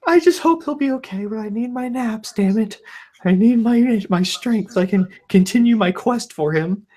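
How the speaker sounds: high, hammed-up whiny voice; mock the lazy owner in a high put-on whine; mock whiny dog owner. Mocking the neighbor who napped while her dog ran loose, high whiny mock voice. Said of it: "It's like".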